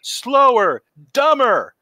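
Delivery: screamed director's order, high and loud; George. Retold or imitated. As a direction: yell each word as a separate command across the set. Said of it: "George would scream".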